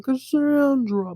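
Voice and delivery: a slow, high, eerie call of her name; drawn-out haunting call. Retold or imitated. Dream voice calling Cassandra's name, slow and high. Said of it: "someone keeps saying".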